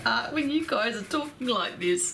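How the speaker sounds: words bubbling out of a laugh. Real, not acted. After a long laughing fit.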